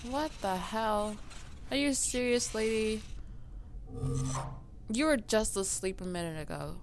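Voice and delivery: ordinary talk, nothing marked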